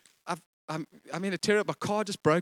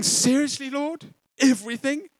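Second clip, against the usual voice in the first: high, incredulous "Seriously, Lord?"; mock disbelief; 7 semitones higher than usual. Imitating a doubtful believer, pitched up. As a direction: churchgoer questioning God, disbelief in a higher voice. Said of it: "You're going".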